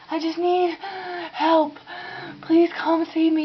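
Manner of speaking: high, slow plea for help; a desperate plea, lightly acted; pleading. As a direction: beg for help, drawn out. Begging operator in dream.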